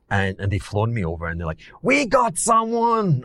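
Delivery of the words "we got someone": put-on high, drawn-out Italian accent; put-on Italian accent, pitched way up and stretched out. Italian billionaires to the fund manager they flew over, put-on Italian accent. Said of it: "I'm putting on an Italian accent".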